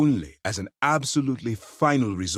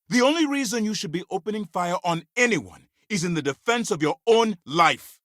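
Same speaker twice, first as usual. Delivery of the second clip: angry reprimand; 9 semitones higher than usual. Acted. Officer scolding an agent about opening fire, angry. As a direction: dress down a reckless agent.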